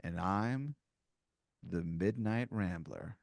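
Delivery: low, soft, slow introduction; mysterious intruder. Acted. Third intruder announcing himself to a player, low and mysterious.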